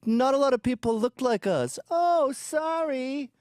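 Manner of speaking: high, mocking whiny voice; a hint of a sniveling refugee; mock whiny immigrant. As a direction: lay on a sniveling tone. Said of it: "and they go".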